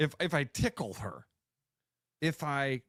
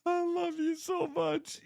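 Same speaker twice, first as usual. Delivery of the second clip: much higher, slow, half crying with laughter; slower than usual. Real, not acted. Host overcome laughing at her co-host's joke, laugh-crying. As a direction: squeal it through helpless laughter.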